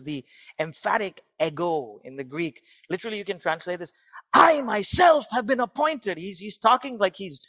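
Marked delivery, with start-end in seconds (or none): 4.3-6.2 loud, slow, higher shout of I myself; jumping with excitement. Retold or imitated. Paul exclaiming to Timothy about his calling, excited shout. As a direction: proclaim it like a man who can't contain his joy. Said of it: "he's jumping with excitement".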